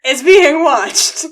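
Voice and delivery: drawn out and loud; slow dramatic emphasis. Acted. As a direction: land it slowly like a reveal. Dramatic reveal of watcher.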